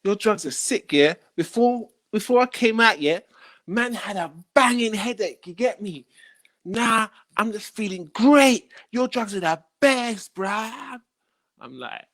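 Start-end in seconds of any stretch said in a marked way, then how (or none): 0.0-11.0 higher, lively voice of the buyer; hint of an excited customer. Buyer gushing feedback to the seller, lightly higher voice. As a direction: gush about the drugs. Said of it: "He says".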